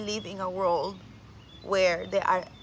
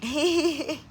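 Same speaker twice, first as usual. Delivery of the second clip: laughing; 8 semitones higher, 3 dB louder than usual. Real, not acted. Laughs at own awkward English.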